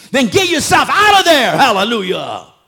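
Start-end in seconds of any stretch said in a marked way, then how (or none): 0.1-1.5 high, loud climax of the call; full-voiced preacher cry; preaching shout. Real, not acted. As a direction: shout it out to the congregation.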